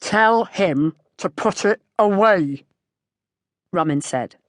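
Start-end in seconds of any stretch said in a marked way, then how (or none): 0.0-2.7 slow, louder, menacing farmer; slow and hostile. A narrator's reading. Farmer repeating his order to the narrator, slow and hostile. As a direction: lean on each word with hostility. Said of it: "slow and hostile".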